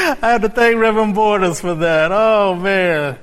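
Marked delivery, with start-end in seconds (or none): none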